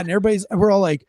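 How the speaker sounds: ordinary talk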